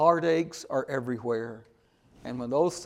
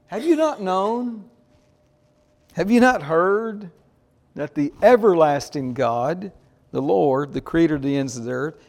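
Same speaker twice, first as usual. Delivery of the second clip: preaching at full force; 2 semitones higher, 5 dB louder than usual. Acted. Reading God's words, loudly.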